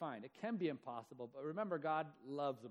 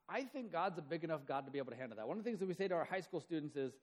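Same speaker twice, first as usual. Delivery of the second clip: ordinary talk, nothing marked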